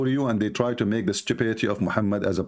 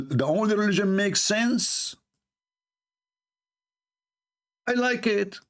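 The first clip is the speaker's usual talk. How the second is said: mock believer voice; 7 semitones higher than usual.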